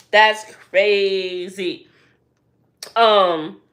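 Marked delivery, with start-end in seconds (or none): 0.1-1.9 "crazy" stretched very long and slow; very slow, elongated "crazy"; drawn-out emphasis. Real, not acted. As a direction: drag out "crazy" in slow disbelief.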